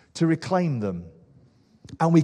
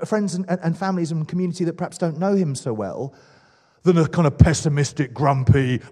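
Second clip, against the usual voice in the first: normal talking voice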